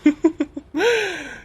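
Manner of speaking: high-pitched laugh, falling away. Acted. Husband hears how the curry was made, laughing. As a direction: laugh out high, then let it drop.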